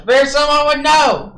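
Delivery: high, slow, drawn-out holler; booming call. Acted. Caster still calling into the distance, high booming holler. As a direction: stretch each word to carry far. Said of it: "as I'm still booming".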